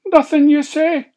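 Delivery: high, loud incredulous cry; a pig crying out in disbelief; cried out. Acted. Slavery Sam crying out at Alan in disbelief. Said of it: "cried Slavery Sam".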